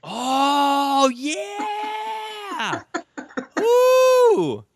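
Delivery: high, loud laughing whoop; excited whoop. Real, not acted. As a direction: cheer with a laugh. Host whoops at Song of Solomon.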